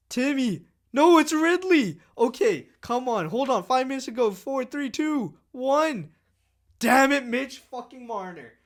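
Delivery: high, loud frantic yells in the final seconds; real panic, shouted high and loud; frantic shouting. Real, not acted. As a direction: count down the clock screaming at the game.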